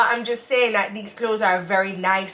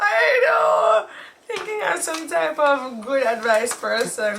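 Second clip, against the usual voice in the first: tearful wail; 5 semitones higher, slower than usual. Real, not acted. Teased friend reacting to her bad advice, tearful high wail. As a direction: cry it out, then slow down as it settles. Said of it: "And [name] does the crying".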